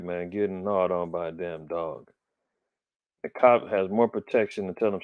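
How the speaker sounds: ordinary talk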